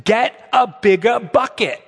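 mock a charismatic British preacher, drawn out; put-on British accent, each word spaced. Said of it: "he said it this way in his British accent".

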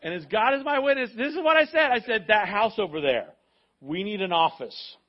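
high and loud, building to the point; excited preaching. Real, not acted. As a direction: preach it at full force. Climax of the story.

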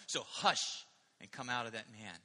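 normal talking voice

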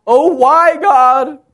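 loud, high-pitched mock lament; hammed-up whine at God; whining. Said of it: "he's kinda whining".

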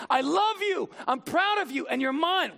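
high, ringing declaration of love; proclaimed love. Retold or imitated. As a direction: lift it up and proclaim it to the whole room. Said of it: "God the Father saying".

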